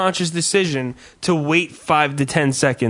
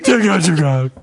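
mock gruff order; 5 dB louder than usual. Mocking McGrath's phone greeting.

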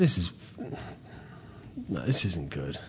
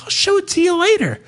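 wife's voice; faster than usual. Retold or imitated. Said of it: "She's like".

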